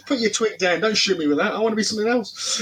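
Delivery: high, laughing mock kid voice; a kid stuck playing stormtrooper, half laughing; whiny playground kid. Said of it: "like, you know".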